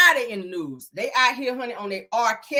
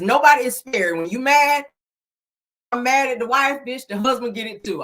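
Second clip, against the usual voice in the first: ordinary talk, nothing marked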